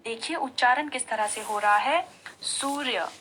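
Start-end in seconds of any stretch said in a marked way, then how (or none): 2.4-3.1 Surya with the weight on the first syllable सू; Surya said slowly with the first sound स stressed. Shown as a demonstration. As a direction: lean into स in Surya, soft र्य ending. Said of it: "यानी स में हम जोर डाल रहे हैं".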